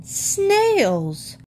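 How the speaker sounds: very high falling cry; high-pitched, drawn-down exclamation; horrified. Acted. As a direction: squeal it in disgusted disbelief. Jonathan shocked by the news.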